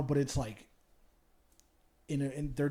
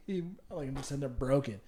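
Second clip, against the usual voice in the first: ordinary talk, nothing marked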